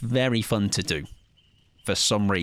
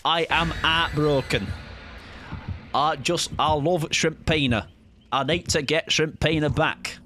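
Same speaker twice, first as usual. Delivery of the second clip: slow, higher Northern English lament; Northern accent; 5 semitones higher than usual. Retold or imitated. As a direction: mope in a thick Northern accent. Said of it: "he speaks in a way".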